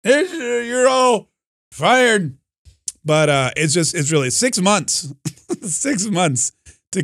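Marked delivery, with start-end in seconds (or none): 0.0-2.4 put-on very high, strained, exasperated old man; slow, hammed-up doddering frustration; Joe Biden. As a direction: curse like a foiled cartoon old-timer. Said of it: "Joe Biden's gotta be like".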